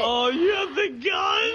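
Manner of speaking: loud, slow, near-crying shout; alarmed shout. Real, not acted. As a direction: yell it in disbelief. Panicked game reaction.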